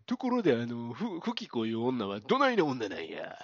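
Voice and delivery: lightly voiced man in fake Osaka dialect; hammed-up Osaka-style questioning; 大和田伸也. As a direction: imitate an actor's bogus Osaka accent. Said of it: "大和田伸也が".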